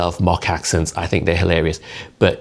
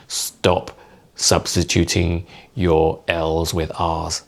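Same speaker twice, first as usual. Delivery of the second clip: ordinary delivery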